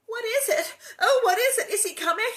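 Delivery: high, loud frightened cry; scared little girl half-crying. A narrator's reading. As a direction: panicky girl asking, near tears. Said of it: "Nellie asked".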